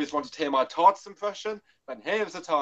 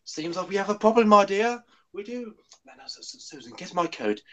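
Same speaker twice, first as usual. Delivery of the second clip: Hartnell impression; 4 semitones higher than usual. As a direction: stammer and fluster through it. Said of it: "gotta do a Hartman impression with me".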